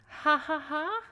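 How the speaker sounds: high, mocking "ha ha ha"; fake laugh. Mocks guest's tasteless joke.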